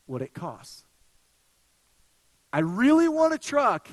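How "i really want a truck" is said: emphatic declaration. Real, not acted. Preacher building to his point.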